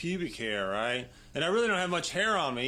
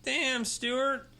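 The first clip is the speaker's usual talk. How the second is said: higher, slow teasing voice as Rita; mock Rita; 8 semitones higher, much slower than usual. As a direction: tease him about his bush, high and drawn out. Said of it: "and Rita goes".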